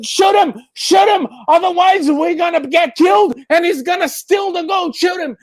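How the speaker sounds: high, loud order to shoot; frantic shout. Acted. Driver screams at the guard to shoot. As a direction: yell at him to fire now. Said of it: "the man turns to the shotgun".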